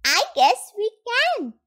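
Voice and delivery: high little character voice; Roo admitting. A narrator's reading. Roo answers cross Rabbit, admitting it. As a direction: concede it in a small high voice. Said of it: "Roo admitted".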